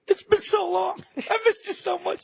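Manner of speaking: hammed-up sobbing reunion; mock tearful. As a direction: gush at the wife as if crying. Mock tearful greeting to wives.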